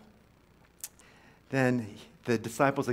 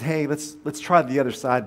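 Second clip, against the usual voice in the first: calling out; 3 semitones higher, 7 dB louder than usual. Acted. Voice from shore calls to boats.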